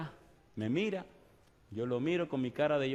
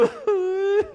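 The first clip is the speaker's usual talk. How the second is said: imitated sob; 9 dB louder than usual. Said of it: "el tipo lloraba y no podía hablar".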